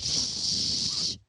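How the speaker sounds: unvoiced shush. Family shushing mom during the horoscope, voiceless hush. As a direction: hush the room. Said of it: "We're like".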